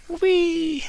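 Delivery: high, squealing whee; a delighted spinning cry; gleeful whee. Acted. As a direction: twirl and squeal with joy. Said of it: "twirling in a circle".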